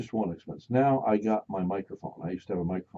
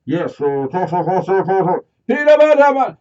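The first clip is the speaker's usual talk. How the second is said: radio chatter; 11 semitones higher, 16 dB louder than usual. Retold or imitated. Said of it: "it's like".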